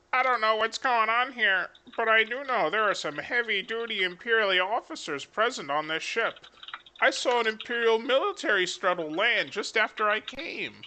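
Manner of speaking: high-pitched gossiping bystander; overheard passenger. Acted. Game master voicing an overheard rumor, high excitable passenger. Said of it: "you hear some people saying".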